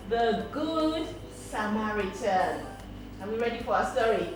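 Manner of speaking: usual speaking voice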